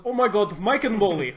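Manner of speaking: high, loud mock Russian yell; mock Russian exclamation; trampoline voice. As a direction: blurt it out like the trampoline guy. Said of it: "in the trampoline voice".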